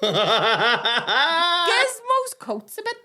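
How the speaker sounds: put-on high, slow, puzzled little-girl voice; puzzled child. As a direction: wonder aloud like a confused small child. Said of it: "I remember going".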